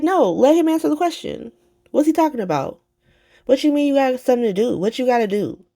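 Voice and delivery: high-pitched, insistent retold challenge; mock-indignant questioning; demanding answers. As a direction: act out someone pressing for an answer. Retelling Alexis demanding answers.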